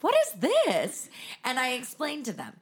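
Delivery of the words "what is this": high, slow baffled question; a guest opening the book, asking high and slow in bafflement; puzzled guest. Retold or imitated. Visitor puzzling over the strange tabletop book, high and drawn out. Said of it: "they open it and they're like".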